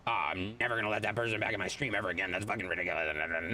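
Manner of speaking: mocking grudge voice. Mocking a viewer who holds a grudge, low and fast. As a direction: sneer it out in a low, fast mock voice. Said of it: "hold it above you like".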